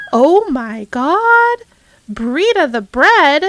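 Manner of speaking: loud, slow, thrilled cry; excited shout. Real, not acted. Streamer greeting a surprise raid, excited shout. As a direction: shout the raider's name with delight.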